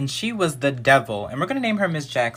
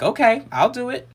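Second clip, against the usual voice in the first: put-on much higher, obliging little-kid voice; childlike agreement; 8 semitones higher than usual. Second grader agreeing to mom's plan, high childlike voice. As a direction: imitate a child agreeing, pitched way up.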